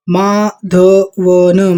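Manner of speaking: normal talking voice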